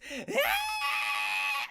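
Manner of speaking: long, loud, high monkey shriek; imitated monkey screech. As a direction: screech like a monkey, full volume. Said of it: "these monkey screeching noises".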